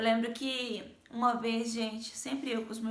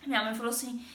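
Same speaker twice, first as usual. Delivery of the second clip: ordinary delivery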